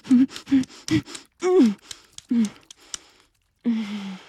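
loud, high moans building to a peak; loud climactic moans; climax moans. Acted. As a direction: let the moans peak and fade. Climax moaning.

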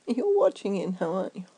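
ordinary delivery

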